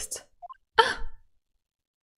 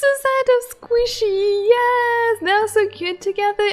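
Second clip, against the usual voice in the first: squealing; 9 semitones higher, 6 dB louder than usual. Real, not acted. Squealing over cute pair.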